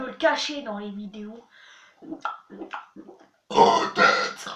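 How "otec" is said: a single burped word. Shown as a demonstration. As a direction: say "Sestra" on a burp. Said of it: "en rotant".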